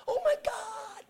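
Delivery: high-pitched scream held back in volume; mock scream. Preacher echoes woman screaming in church, high restrained mock scream. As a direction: imitate a scream without the full volume. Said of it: "She screamed".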